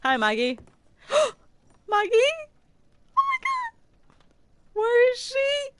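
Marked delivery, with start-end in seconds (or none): 1.1-5.7 high, loud gasp of alarm; startled squeal of disbelief; panicked high cry; shocked gasp. Real, not acted. Missing friend in game.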